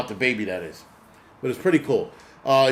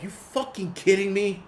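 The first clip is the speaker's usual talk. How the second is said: higher-pitched incredulous question; exasperated disbelief; 6 semitones higher than usual. Retold or imitated. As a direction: replay your disbelief, pitched up. Replays his disbelief at the scene.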